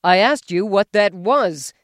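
loud, rising hysterical demand; husband shrieking at wife in the dark. A narrator's reading. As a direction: lose control, voice climbing. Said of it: "his voice rose hysterically".

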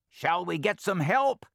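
high, loud flustered weasel. Acted. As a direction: ask in a nervous fluster.

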